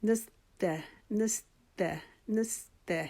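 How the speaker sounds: نستع with سین and تا light and thin; thin letters. Shown as a demonstration. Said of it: "باریک پڑھنا ہے".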